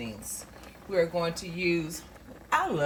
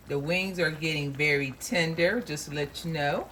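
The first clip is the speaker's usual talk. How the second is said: usual speaking voice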